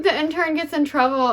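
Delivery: usual speaking voice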